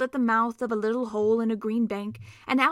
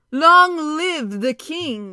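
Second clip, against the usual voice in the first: loud, slow, ringing centaur cry; cried out; 5 semitones higher, 10 dB louder, much slower than usual. Acted. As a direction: hail the king with a ringing cry. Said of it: "he cried".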